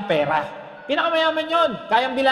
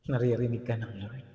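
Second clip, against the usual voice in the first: low, soft and hushed; hushed reply; 13 semitones lower, 10 dB quieter than usual. Acted. Pastor telling the doubting listener the Lord hears, low and hushed. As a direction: drop the voice low, a gentle aside. Answering each thought, hushed.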